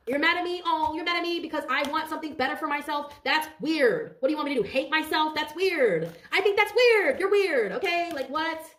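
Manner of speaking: playful outrage at jealous people; mock indignant rant. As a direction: talk back to the haters with mock disbelief. Sassing back at jealous haters.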